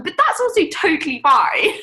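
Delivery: high, loud, laughing protest; pitched right up, loud, breaking into laughter; voice shooting up high. Real, not acted. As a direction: let the pitch climb as you laughingly insist. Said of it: "Your voice is going seven octaves high".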